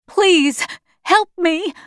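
high, urgent begging; desperate plea. A narrator's reading. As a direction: beg an officer for help. Child begs officer for help.

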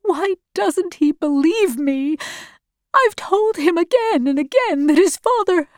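high, pleading tearful voice; starting to cry. A narrator's reading. Mother despairs that her son won't believe her, tearful. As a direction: plead as tears begin, pitched high. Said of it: "She began to cry".

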